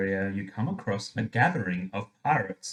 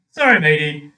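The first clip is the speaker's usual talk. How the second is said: louder, lightly voiced pirate captain; 4 semitones higher, 10 dB louder than usual. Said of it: "the captain says with a shrug".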